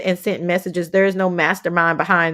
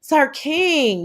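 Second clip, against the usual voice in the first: normal talking voice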